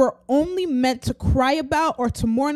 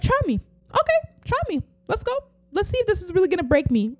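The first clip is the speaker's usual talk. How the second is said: much higher, daring try me; defiant challenge; 7 semitones higher, faster than usual. Retold or imitated. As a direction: throw down a bold challenge. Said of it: "you're gonna be like".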